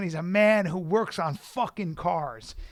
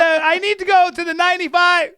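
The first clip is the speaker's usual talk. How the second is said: blurted much higher and louder; flustered squeak; 13 semitones higher, 11 dB louder than usual. Retold or imitated. Asking the mechanic for directions, a high loud flustered blurt. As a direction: give up the act and squeak out the request.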